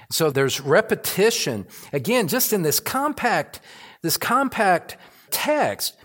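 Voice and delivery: loud, climbing pulpit delivery, pressing each phrase; full-force preaching. Acted. Pastor preaching to his congregation, loud and fervent. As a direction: preach it out with rising fervour.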